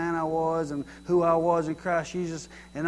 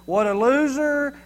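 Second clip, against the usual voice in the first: higher, drawn-out put-down; mock scorn; 6 semitones higher, 4 dB louder, slower than usual. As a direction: stretch it out as an imagined scornful wife. Voicing what wife could have said.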